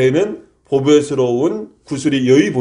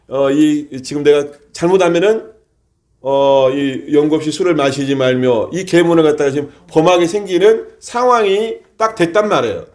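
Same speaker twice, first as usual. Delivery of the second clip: normal talking voice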